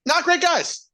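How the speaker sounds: hammed-up mock prim voice; sarcastic echo. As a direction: echo it in a put-on high voice. Echoes sarcastically.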